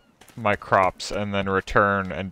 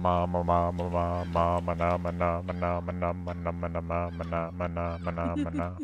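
loose-jawed ma na babble, softer; floppy jaw ya ya drill; 4 semitones lower, 5 dB quieter than usual. Shown as a demonstration. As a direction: let the jaw flop on each ma. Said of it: "Can I get you guys to say stuff?".

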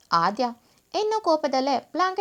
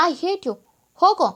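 higher, ending in a loud dismissal; angry outburst; 5 semitones higher, 6 dB louder than usual. A narrator's reading. Ignored wife sending her husband away, angrily. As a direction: push him away with a sharp, raised voice.

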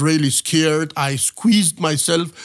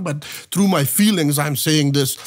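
usual speaking voice

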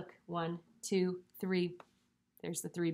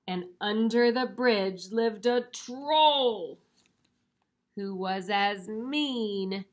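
louder, dramatic read-aloud, slowing down; storybook reading; 3 semitones higher, 6 dB louder, slower than usual. A narrator's reading. Introducing the mean troll to children, storybook suspense. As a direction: build up the troll, then slow right down.

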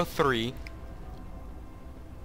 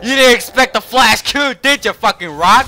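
higher and louder, jeering at the beaten enemy; gloating taunt; 8 semitones higher, 9 dB louder than usual. Real, not acted. Streamer taunting the enemy Malphite after killing him, loud gloating. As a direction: rub it in, loud and cocky.

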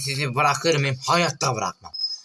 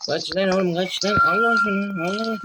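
rising panicked cries at the screen; yelling at the game in panic; 8 semitones higher, 3 dB louder than usual. Real, not acted. Gamer yelling at the game to run, panicked.

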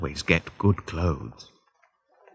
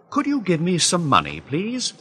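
higher, quick pleading; pleading request; 9 semitones higher than usual. A narrator's reading. Narrator asking a frightened old man for money, pleading. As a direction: ask him, fast and hopeful.

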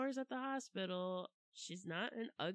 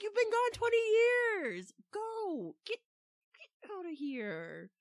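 exasperated scolding; 9 dB louder than usual. Retold or imitated. Scolding Odysseus in retold rant.

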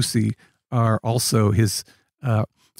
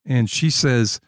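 normal talking voice

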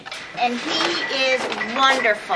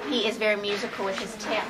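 usual speaking voice

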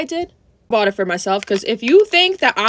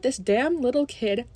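ordinary delivery

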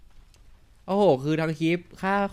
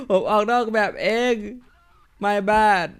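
ผมออกนอกแมปเอง said higher and louder through a laugh; laughing realisation; 7 semitones higher, 6 dB louder, much slower than usual. Real, not acted. Gamer admits to viewers he walked off the map, laughing.

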